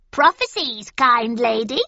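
high, loud wheedling hag; witch voice. A narrator's reading. Witch offers prophecies.

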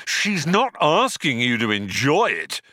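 irritated yell at a sulking robot; shouting. A narrator's reading. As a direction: shout it impatiently. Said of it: "he shouted".

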